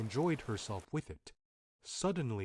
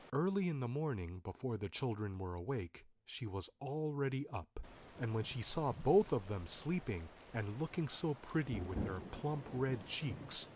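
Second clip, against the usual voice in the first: plain reading voice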